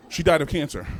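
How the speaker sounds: usual speaking voice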